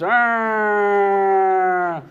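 long high wailing siren noise; imitated ambulance siren. Teller imitates the ambulance arriving, siren wail.

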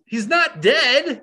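high, drawn-out disbelief; real exasperated protest; incredulous. Real, not acted. Incredulous rant about film logic.

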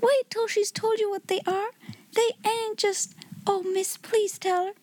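high-pitched, softly pleading Becky; eager appeal. A narrator's reading. Becky begging Sarah to describe the magic, eager appeal. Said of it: "appealing to Sarah".

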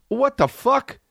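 high-pitched mock alarm; acted shock. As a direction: exclaim it in squeaky disbelief. Acts out his shock at the dogs.

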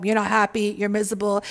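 ordinary delivery

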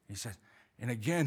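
usual speaking voice

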